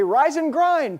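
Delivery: high, loud mock rallying cry; mock pep call. Student rousing friends passed out after a party, mock pep. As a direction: call it out like a wake-up cheer.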